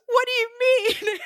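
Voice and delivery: high, loud retold disbelief; loud, high-pitched bafflement; incredulous question. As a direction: act out a stunned food runner. Food runner's disbelief, acted out.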